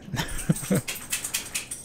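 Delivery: soft, high, airy laugh. Real, not acted. Player realises it needed broadcasting, soft self-mocking laugh. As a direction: laugh quietly at your own mistake. Laughs at own silly mistake.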